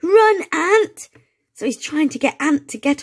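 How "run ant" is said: very high, loud urgent shout; exclamation. Acted. Max warning Ant to flee the hen, loud urgent shout. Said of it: "with an exclamation mark".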